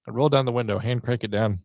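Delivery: normal talking voice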